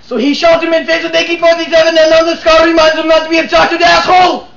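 shouted punchline. Acted. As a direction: yell the twist ending.

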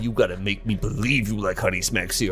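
low menacing threat. Acted. Frog threatening the child's parents, low menace. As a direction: drop down low and lay out the ultimatum.

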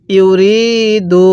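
ইউরিদু chanted very slowly, each syllable held; teacher modelling ইউরিদু in slow tajweed chant; slow drawn-out recitation. Shown as a demonstration. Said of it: "কিভাবে পড়ব?".

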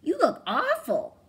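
high, loud mothering voice; mother's voice. A narrator's reading. Mama Hyena tells Penduli she looks awful. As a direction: scold your muddy child.

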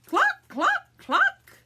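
very high, loud, separate clucks; chicken clucking. Acted. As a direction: squawk each cluck like a hen. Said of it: "Chickens say".

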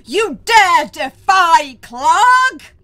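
slow, booming roar of a furious villain; booming echoing villain monologue. Acted. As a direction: bellow it out slowly like a warlord. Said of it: "and he goes".

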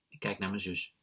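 plain reading voice

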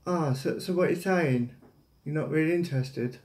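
ordinary talk

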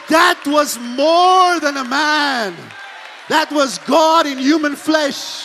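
loud, high and slow; proclaiming shout. Retold or imitated. Branham proclaiming to the lady, a slow loud shout. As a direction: proclaim it with full force.

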